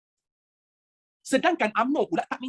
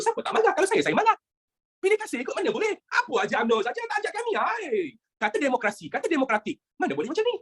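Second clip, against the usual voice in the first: high-pitched, indignant grumbling; sulky mock anger; 6 semitones higher than usual. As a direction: act out an aggrieved, left-out complainer. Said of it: "Mengajuk lah". (merajuk, pura-pura marah dengan nada tinggi)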